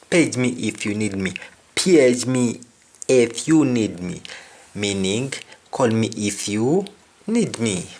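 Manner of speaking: ordinary delivery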